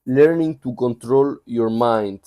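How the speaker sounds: ordinary delivery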